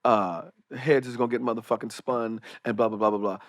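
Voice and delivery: low, soft street-tough mimicry; imitated tough talk. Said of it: "Janet's talking like this".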